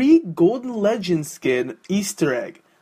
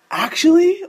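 incredulous squeak; 11 semitones higher, 4 dB louder than usual. Acted. Disbelief at April Fools.

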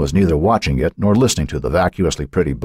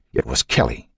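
ordinary delivery

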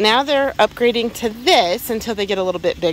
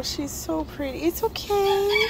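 soothing a scared animal; 9 semitones higher, 6 dB quieter than usual. Real, not acted. Soothing a handled snake.

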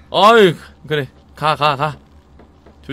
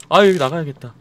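usual speaking voice

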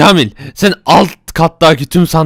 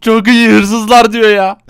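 mocking; 8 semitones higher, 3 dB louder, much slower than usual. Boss mocks henchman's boast.

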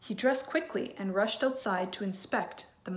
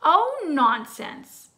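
higher, louder, slow brush-off; dismissive wife; 7 semitones higher, 9 dB louder, much slower than usual. Acted. Wife brushing off the giant's suspicion, slow and dismissive. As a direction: wave his worry away breezily. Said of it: "the giant's wife replied".

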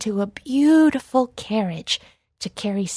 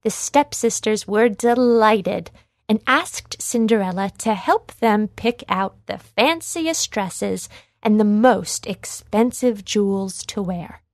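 ordinary delivery, nothing marked

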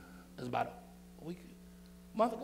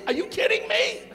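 incredulous; 5 dB louder than usual. Real, not acted. Pastor mocking kids wanting school.

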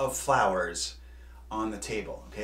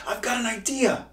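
high, louder burst of a new idea; excited exclamation; 11 semitones higher, 5 dB louder than usual. A narrator's reading. Hugo on the tower, exclaiming his idea. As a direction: blurt the idea out with delight. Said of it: "Hugo looked up and exclaimed".

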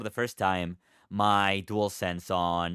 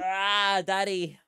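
stretching groan; 9 semitones higher, much slower than usual. Real, not acted.

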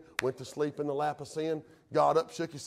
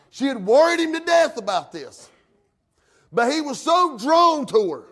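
raised, loud pulpit cry; impassioned preaching; 10 semitones higher, 10 dB louder than usual. Real, not acted. Preacher tells of Samson worried to death, impassioned preaching. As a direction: drive the story home with force.

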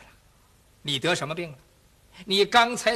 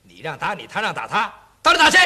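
impatient mockery; 2 semitones higher, 7 dB louder, much faster than usual. 元帅不耐烦质问.

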